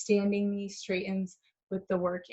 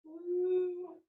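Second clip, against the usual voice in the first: high playful whoop; excited woo; 9 semitones higher, 6 dB quieter than usual. Retold or imitated. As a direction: let out a little cheer. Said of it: "you're gonna be tempted to go".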